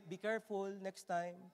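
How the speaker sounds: soft, low-key caution; quiet, mild reminder; no shouting. Shown as a demonstration. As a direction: say it gently instead of yelling. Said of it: "Ba't kailangan sumigaw?".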